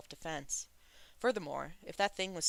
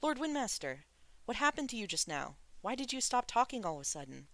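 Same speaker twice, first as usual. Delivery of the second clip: hurried asking; 7 semitones higher than usual. A narrator's reading. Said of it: "Shulian hurriedly asked".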